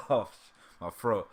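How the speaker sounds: ordinary talk, nothing marked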